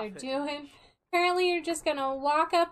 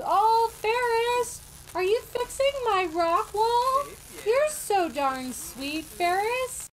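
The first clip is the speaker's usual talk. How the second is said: gushing; 9 semitones higher, 5 dB louder, slower than usual. Pretend. Gushing over Ferris fixing wall.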